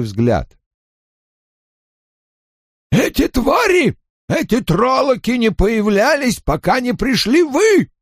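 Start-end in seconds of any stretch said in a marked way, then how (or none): 2.9-7.9 high accusing shout. A narrator's reading. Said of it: "едва слышно промямлил Кен".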